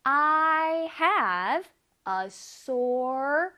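very slow, careful English; slow teacher demo; slow model reading. Shown as a demonstration. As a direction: read each word slowly for students. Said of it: "따라해볼게요".

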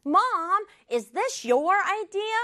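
high, drawn-out questioning of Mom; slightly accusing. Acted. Child confronting Mom about whose idea it was, accusing. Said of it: "이거 지우가 약간 따지는 말투예요".

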